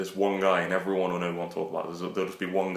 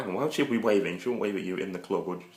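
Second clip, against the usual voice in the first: normal talking voice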